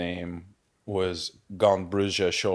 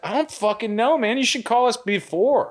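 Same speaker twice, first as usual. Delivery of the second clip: exasperated retort; 13 semitones higher than usual. Retold or imitated. Retold exasperated reply to friend.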